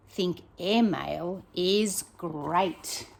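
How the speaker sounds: ordinary delivery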